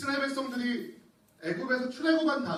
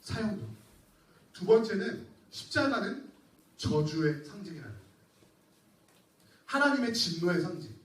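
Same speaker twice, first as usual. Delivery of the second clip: ordinary talk, nothing marked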